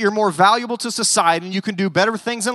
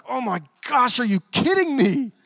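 higher-pitched, incredulous mock outburst; exasperated disbelief; 3 semitones higher than usual. Imagined Jesus to his bickering disciples, exasperated disbelief. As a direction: act out a fed-up teacher who can't believe it. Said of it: "just getting so frustrated and going".

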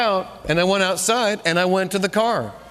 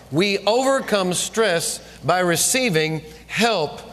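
ordinary talk

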